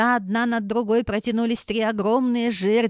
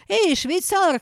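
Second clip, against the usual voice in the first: calling out; 8 semitones higher, slower than usual. A narrator's reading. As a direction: shout toward the back door. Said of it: "крикнула она".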